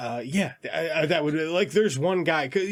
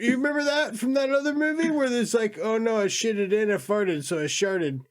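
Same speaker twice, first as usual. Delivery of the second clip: dumb comedy voice; 8 semitones higher than usual. Retold or imitated. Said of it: "dick and fart jokes".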